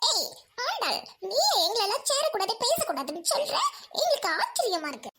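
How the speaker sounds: high, swooping put-on voice; squeaky, incredulous up-and-down voice; excitable friend, astonished. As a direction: protest in exaggerated sing-song.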